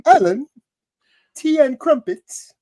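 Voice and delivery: high, hammed-up English accent. Co-host retrying his English accent, hammed-up English accent. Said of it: "just say one word in an accent".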